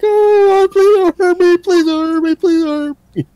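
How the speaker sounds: very high squeaky pleading; hammed-up cowering victim; terrified whimpering. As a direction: beg for mercy in a tiny high whimper. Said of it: "hear her whimpering and scream".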